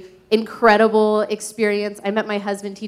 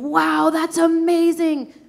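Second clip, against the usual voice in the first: delighted mom; 7 semitones higher than usual. Retold or imitated. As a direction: marvel at a child's Lego creation. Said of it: "he just wants my gaze".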